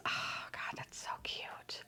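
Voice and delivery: soft whisper, slow; hushed awe at something cute; whispered cooing. Real, not acted. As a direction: breathe it like you're melting over a puppy. Cooing at toy.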